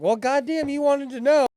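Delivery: loud, high-pitched mock protest; exasperated comeback. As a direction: yell it back, indignant and comic. Retold comeback to his mom.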